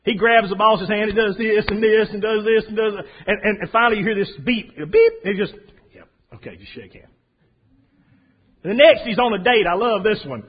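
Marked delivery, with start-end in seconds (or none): 5.9-7.1 meek mumble. Acted. Guy obeys the warning beep.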